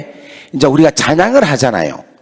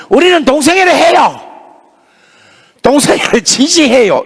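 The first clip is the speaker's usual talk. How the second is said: imitating defiant students; 8 semitones higher than usual. Students answering the pastor's question, high defiant voice. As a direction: voice the kids declaring it proudly. Students declare their stance.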